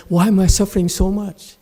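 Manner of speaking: a touch of mock whining; mock whine. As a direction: imitate a complaining student. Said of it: "you're also whining and say".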